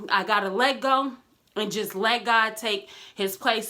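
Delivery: usual speaking voice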